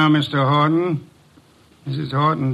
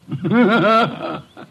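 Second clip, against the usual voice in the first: amused teasing chuckle; 5 semitones higher than usual. Acted. Detective teasing Archie over his lost romance, amused chuckle. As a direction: laugh at your assistant's bad luck.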